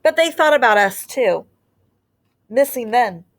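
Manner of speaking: put-on gentle storybook read-aloud for young listeners; teacher reading a picture book to her class; reading to children. A narrator's reading. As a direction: read it like story time on the rug.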